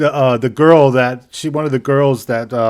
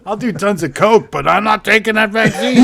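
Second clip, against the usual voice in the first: higher, lightly voiced stubborn partier; hammed-up party guy; 8 semitones higher, 3 dB louder than usual. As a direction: brag about coke, refuse the shot. Imitating coke-loving anti-vaxxer.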